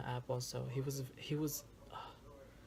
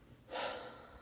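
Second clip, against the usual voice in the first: heavy sigh; 6 dB quieter than usual. Real, not acted. Sighs before returning to dating.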